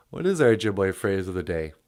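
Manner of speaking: very low, fast voice; very deep voice. Pretend. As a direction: drop the voice very low. Put-on deep voice.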